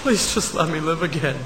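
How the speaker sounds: broken whispered prayer. Acted. George praying alone on the bridge, hushed broken plea. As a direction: beg almost inaudibly, spent.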